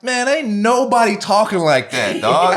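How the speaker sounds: high, loud, amused disbelief; cracking up while mocking the movie talk; laughing protest. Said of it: "but I'm laughing".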